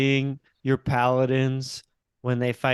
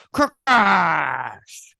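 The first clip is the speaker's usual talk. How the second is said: long drawn-out, higher mock cry of "crash"; imitated crash cry; 4 semitones higher, 4 dB louder than usual. Host imitates what Ben went when a bird was hit, drawn-out mock cry. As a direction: draw the word out in an imitation of Ben's cry. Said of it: "where I heard Ben go".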